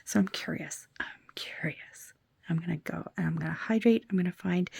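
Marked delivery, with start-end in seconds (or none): 1.0-2.1 slow, breathy whisper of curiosity. Shown as a demonstration. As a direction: whisper it slowly, teasing. Repeats it in a whisper.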